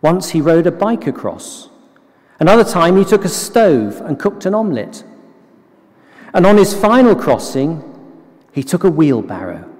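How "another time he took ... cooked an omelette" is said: normal talking voice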